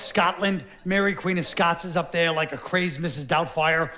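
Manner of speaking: ordinary talk, nothing marked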